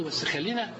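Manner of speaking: ordinary delivery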